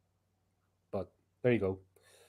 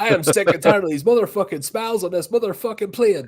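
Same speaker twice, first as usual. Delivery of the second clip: loud furious action-hero rant; angry movie shout; 6 semitones higher, 5 dB louder than usual. Acted. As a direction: yell it like a fed-up hero. Samuel L. Jackson parody line.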